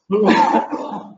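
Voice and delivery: hard real coughing, choked on a drink; coughing fit. Real, not acted. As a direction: cough to clear a drink gone down wrong. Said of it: "mich verschluckt".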